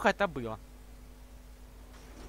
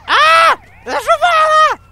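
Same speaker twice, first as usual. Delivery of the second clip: shout; 12 dB louder, slower than usual. Real, not acted. Player yelling at game glitch.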